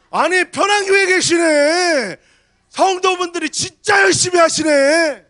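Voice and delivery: loud, high, slow, hammed-up delight; mock shouted praise. Pastor hamming up a visitor's praise, shouted. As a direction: boom it out like a visitor gushing over the church.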